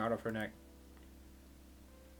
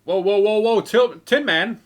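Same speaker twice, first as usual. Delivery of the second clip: loud, higher yelling of a name; excited shout; 9 semitones higher, 16 dB louder than usual. Acted. Mercenary spotting Tin Man, loud excited shout. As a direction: call across the street to an old friend. Character calls out to a friend.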